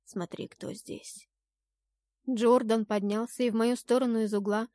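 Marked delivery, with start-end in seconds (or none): 0.0-1.3 very soft, slow near-whisper; breath-soft and intimate; tender hush. A narrator's reading. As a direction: reveal a surprise gently. Jordan gently presents a surprise.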